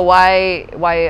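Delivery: usual speaking voice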